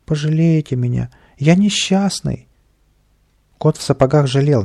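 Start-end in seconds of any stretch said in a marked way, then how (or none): 0.1-2.5 fake crying. A narrator's reading. Little man kneels to win the cat's pity, feigned tears. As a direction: plead pitifully in a put-on high whine. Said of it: "притворно тереть кулачками глаза, будто заплакал".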